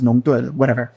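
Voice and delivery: ordinary delivery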